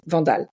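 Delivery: "Vandal" as said in France, "vahn-dahl"; "Vandal", French open a's; French pronunciation. Shown as a demonstration. Guest gives the real French form of her name. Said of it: "when I speak in France".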